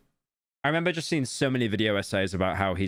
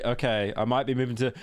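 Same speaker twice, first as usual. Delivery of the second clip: usual speaking voice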